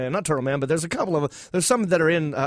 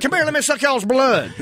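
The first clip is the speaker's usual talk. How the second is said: hammed-up backwoods vampire calling, pitched up; 11 semitones higher, 6 dB louder than usual. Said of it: "a redneck vampire".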